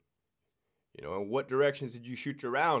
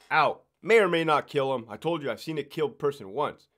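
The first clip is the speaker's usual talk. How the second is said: ordinary talk, nothing marked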